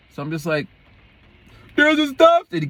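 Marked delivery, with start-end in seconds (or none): 1.7-2.5 high, loud mock yell; raised, high-pitched shout, cut off; imitated shouting. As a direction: mimic the man hollering a warning. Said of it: "he's shouting".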